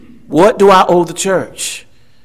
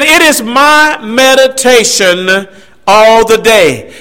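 slow, savoring quote; 3 semitones higher, 3 dB louder, slower than usual. Retold or imitated. Preacher repeating the psalmist's words slowly. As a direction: stretch "It is my meditation" out slowly, opening a little high. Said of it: "He said".

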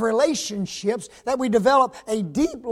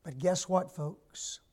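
much softer, lower, intimate; hushed reassurance; soft drop; 3 semitones lower, 9 dB quieter than usual. Acted. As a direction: pull back and confide. Sudden drop after loud build.

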